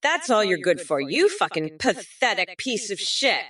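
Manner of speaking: ordinary talk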